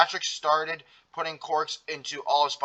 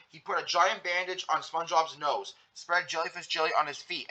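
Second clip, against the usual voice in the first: usual speaking voice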